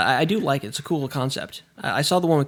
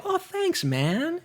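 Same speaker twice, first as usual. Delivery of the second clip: grateful guy; 13 semitones higher, 6 dB quieter, much slower than usual. Retold or imitated. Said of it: "and they go".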